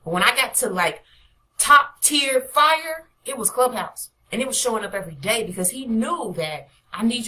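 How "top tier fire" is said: very slow, loud stretched phrase; drawn out. Real, not acted. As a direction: lean hard on every word.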